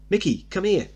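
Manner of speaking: high, loud sharp summons; a mother calling her son back in anger; angry mother summoning her boy. Acted.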